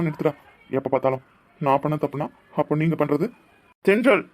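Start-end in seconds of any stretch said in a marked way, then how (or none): 3.9-4.2 calling out. Acted. Calling out to his friend.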